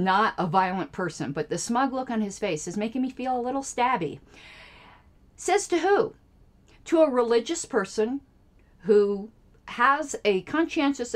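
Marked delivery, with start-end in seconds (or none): 5.4-6.1 "Says to who?" jumps much higher in disbelief; incredulous challenge. Real, not acted. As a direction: fire it back high and outraged.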